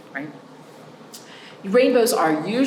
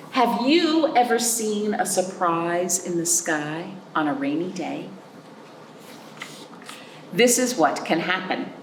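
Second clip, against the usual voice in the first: slow, lilting storybook read; picture-book voice, drawn out; reading to children; 3 semitones higher, much slower than usual. Acted. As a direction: read it aloud to a class of little ones.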